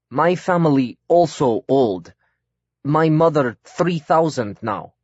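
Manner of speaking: put-on slow, deliberate foreign-accented broken English. A narrator's reading. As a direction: speak careful broken English with a foreign lilt.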